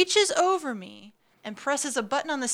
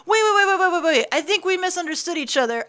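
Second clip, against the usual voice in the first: panicked; 6 semitones higher, faster than usual. Retold or imitated. Retold panicked stopping of date.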